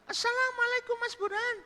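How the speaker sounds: very high falsetto greeting; woman's greeting. Acted. Woman arriving at Burhan's house, high falsetto.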